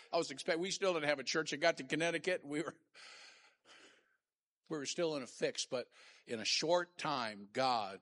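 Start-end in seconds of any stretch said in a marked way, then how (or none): none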